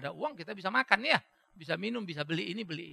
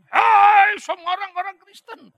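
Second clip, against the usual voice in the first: slow, much higher, much louder rally cry; shouted speech; 14 semitones higher, 20 dB louder, slower than usual. Retold or imitated. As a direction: call out to the whole hall. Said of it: "Dia teriak begitu".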